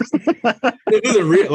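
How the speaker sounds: slow, high laughing disbelief. Real, not acted. Guest laughing at coin names.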